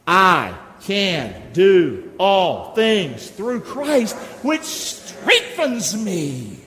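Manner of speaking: very slow, each word stretched; slow declaration. Shown as a demonstration. Pastor leads church in reciting the verse, slow and firm. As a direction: lay it down slow and firm for the crowd. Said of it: "say this one with me".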